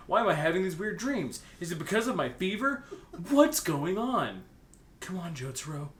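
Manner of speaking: usual speaking voice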